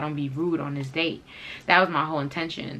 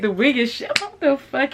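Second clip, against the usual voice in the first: reenacted alarm; 10 semitones higher, 6 dB louder than usual. Reenacting alarm as wig is grabbed.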